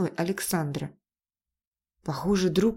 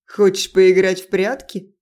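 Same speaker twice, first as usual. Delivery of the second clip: taunting, louder call from the dark; mocking; 9 dB louder than usual. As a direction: tease the hiding man. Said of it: "насмешливый голос Тейна".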